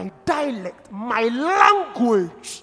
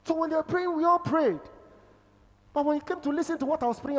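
normal talking voice

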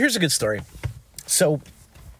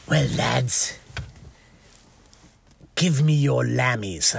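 Guy Ritchie gangster; slower than usual. Retold or imitated. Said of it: "totally a character out of a Guy Ritchie movie".

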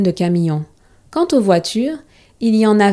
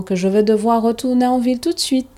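plain reading voice

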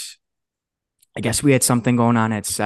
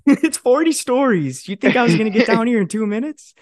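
much higher, incredulous, loud; exasperated retort; 12 semitones higher, 5 dB louder than usual. Retold or imitated. Employee defending himself to boss, much higher and incredulous. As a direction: argue back in disbelief.

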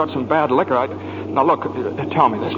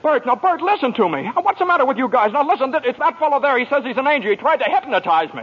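desperate pleading; 9 semitones higher than usual. Acted. George pleading desperately with the cop.

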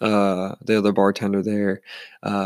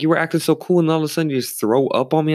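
higher, aggrieved protest; mildly outraged, pitched up; retold indignant complaint; 7 semitones higher, faster than usual. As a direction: act out scolding the offender. Acted quarrel with the drunk guy.

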